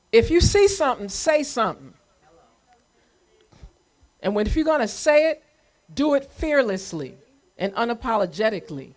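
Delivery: loud, high, urgent call to the crowd; rallying call. Real, not acted. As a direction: project it out like a rally cry, pushing each phrase.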